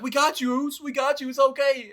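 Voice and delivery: high, comforting impression; a light impression of a reassuring brother; imitating Jimmy comforting. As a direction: soothe a crying cousin. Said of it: "Jimmy's like".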